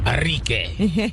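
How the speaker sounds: mock Spanish accent. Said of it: "That was your accent".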